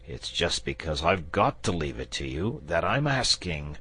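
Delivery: ordinary delivery, nothing marked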